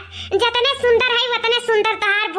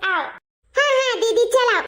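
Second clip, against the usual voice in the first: ordinary talk, nothing marked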